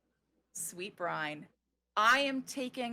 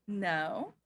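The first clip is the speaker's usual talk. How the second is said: ordinary talk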